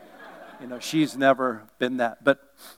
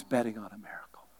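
whisper; 5 semitones lower, 15 dB quieter, faster than usual. Real, not acted. Aside to congregation.

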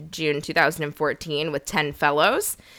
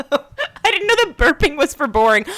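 laughing; 9 semitones higher, 4 dB louder than usual. Real, not acted. Laughing reply about burp.

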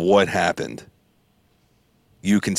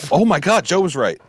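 mock surprise; 7 semitones higher than usual. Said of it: "And you're gonna go".

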